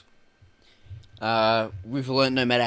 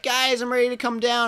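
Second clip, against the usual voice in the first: mock casual; 11 semitones higher than usual. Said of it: "he's like".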